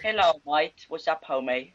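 low-pitched put-on British greeting; mock British accent, pitched low. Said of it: "now you gonna begin talking British".

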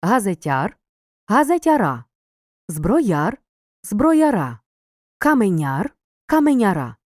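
teacher shows the stress jumping onto the ending; stress moving to ending. Shown as a demonstration. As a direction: lift the voice on the final а of each longer form. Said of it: "наголос переходить з основи на закінчення".

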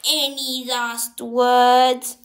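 slow, loud villain taunt; slow theatrical villain menace. Acted. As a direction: savour the threat slowly.

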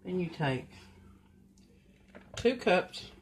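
ordinary talk, nothing marked

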